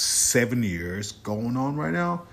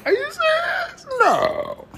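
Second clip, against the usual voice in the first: shrill, much higher, cracking disbelief; over-the-top fake shock; mock outrage; 7 dB louder, slower than usual. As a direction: squeal it like you can't believe it. Mock disbelief about someone leaving.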